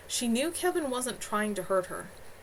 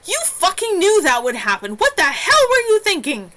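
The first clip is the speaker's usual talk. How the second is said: loud, high, furious yelling; furious shout; 11 semitones higher, 13 dB louder than usual. A narrator's reading. Jess yelling at the healer who nearly burned out, furious shout. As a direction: blow up at her in a rage. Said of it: "and he was furious".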